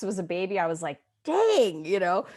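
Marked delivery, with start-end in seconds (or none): 1.2-2.3 mock dismay. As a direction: react like a puppy just bit you. Reacting to puppy's sharp teeth.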